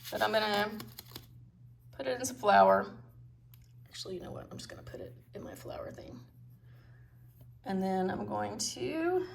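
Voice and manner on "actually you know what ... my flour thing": whispering. Real, not acted. Quiet aside while baking.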